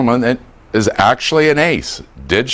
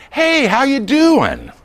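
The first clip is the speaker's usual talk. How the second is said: bright friendly greeting; 14 semitones higher, slower than usual. Shown as a demonstration. Acting brain's friendly greeting.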